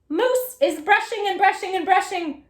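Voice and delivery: excited. Shown as a demonstration. Lively story reading to children.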